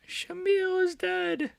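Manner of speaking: high, slow dramatic wail; mock announcer wail. Real, not acted. Streamer mourning the fighter, mock wail. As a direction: stretch it out like a eulogy. Repeats knockout call.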